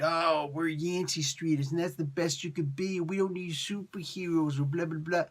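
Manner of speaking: put-on voice mocking neighborhood tough guys; mock street-gang locals. Reviewer mocking Yancey Streeters who scorn superheroes, put-on voice. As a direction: imitate boastful locals, trailing into dismissive blah-blah. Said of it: "Yancey Streeter's like".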